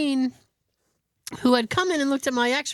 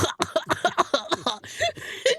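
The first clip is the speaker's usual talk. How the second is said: high choked laughter; choke laughing; 7 semitones higher than usual. Retold or imitated. Patient stifling laughs with tool in mouth, choke laughing. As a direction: laugh as if trying to hold it back and choking. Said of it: "I'm choke laughing".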